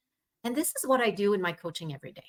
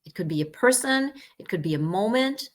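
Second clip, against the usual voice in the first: guided meditation; 5 semitones lower than usual. Acted. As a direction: lead a gentle breath exercise. Leads a breathing exercise.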